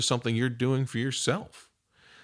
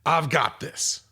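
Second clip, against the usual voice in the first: retold yell; 4 semitones higher, slower than usual. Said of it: "you'll hear somebody yell out".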